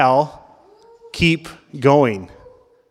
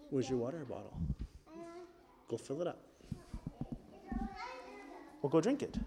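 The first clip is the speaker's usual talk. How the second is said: off mic aside; 27 dB quieter, much faster than usual. Real, not acted.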